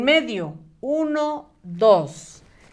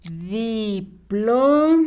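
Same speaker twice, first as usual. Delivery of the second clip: Diploma very slow, di... plo... ma; syllable-by-syllable dictation; much slower than usual. Shown as a demonstration. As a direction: break Diploma into long held syllables. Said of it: "A ver, escribimos".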